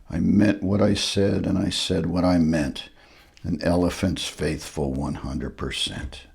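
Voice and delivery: soft, low, faithful elephant vow; Horton's vow. Acted. Horton to himself in the noisy tent, soft and steadfast. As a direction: promise it quietly, sadly. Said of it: "but he said as he sat".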